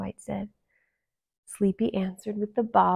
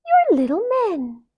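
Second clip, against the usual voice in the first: high, loud amazed princess; surprised; 11 semitones higher, 9 dB louder than usual. A narrator's reading. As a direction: marvel at them.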